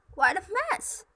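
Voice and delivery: high, loud jeer; mocking stepsisters. A narrator's reading. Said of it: "her two stepsisters laughed".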